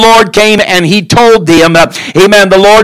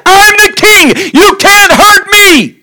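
much higher, pleading protest of a desperate king; whining Saul; 11 semitones higher than usual. Acted. Saul begging Samuel not to take his throne, high whine. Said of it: "And Saul saying".

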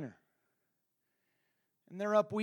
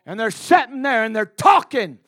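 full-force preaching; 21 dB louder than usual. Acted. Preacher rebuking the congregation for judging, full-force preaching. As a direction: thunder it out to the congregation.

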